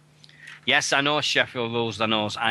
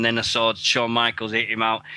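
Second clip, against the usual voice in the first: normal talking voice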